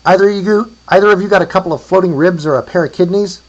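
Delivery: high, loud holler; calling across distance. A narrator's reading. Asking traders for ribs or kidneys, calling out. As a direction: shout the request to all around.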